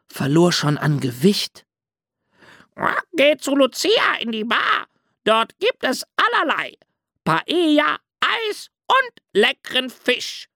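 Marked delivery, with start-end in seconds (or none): 3.1-10.5 hohe, kreischende Papageienstimme; krächz die Empfehlung wie ein aufgeregter Papagei; kreischender Papagei. A narrator's reading. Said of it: "kreischte ein Papagei". (hoch und kreischend)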